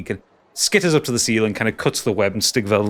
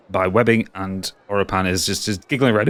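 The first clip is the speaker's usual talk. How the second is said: ordinary talk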